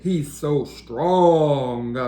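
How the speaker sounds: slow, weighty tough-cat voice; slow strong cat. Acted. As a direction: drag it out like a big tough guy. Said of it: "said Spike".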